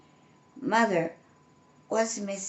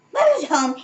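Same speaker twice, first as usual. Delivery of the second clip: higher, louder joyful cry; happy yelp; 8 semitones higher, 6 dB louder than usual. A narrator's reading. Cub yelping as he runs to greet mother. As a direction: yelp with joy. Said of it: "he yelped".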